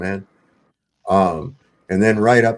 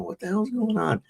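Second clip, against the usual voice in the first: alarmed Leanne; 11 semitones higher, 6 dB quieter than usual. Retold or imitated. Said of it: "Leanne's going".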